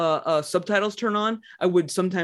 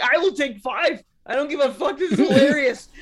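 high giddy laughing voice; giddy and laughing, pitched way up; 8 semitones higher than usual. Retold or imitated. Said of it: "me giddy in GameStop".

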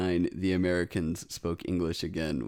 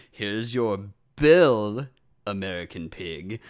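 mock snooty waiter; 6 dB louder, slower than usual. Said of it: "and they say".

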